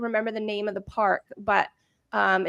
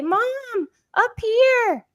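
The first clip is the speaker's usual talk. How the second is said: put-on high, small child calling down from above; little boy's voice; 13 semitones higher, 5 dB louder, slower than usual. Son calls down to his panicked mom, high and small. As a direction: pipe up like a kid hiding at the top. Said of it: "this little voice from up top say".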